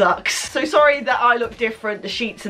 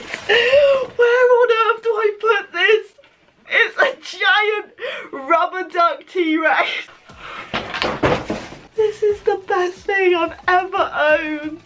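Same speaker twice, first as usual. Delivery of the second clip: much higher, laughing, slow disbelieving squeal; real delighted shriek; 8 semitones higher, slower than usual. Real, not acted. Unboxing the giant duck for viewers, shrieking with delight. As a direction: burst out with real joy at the size.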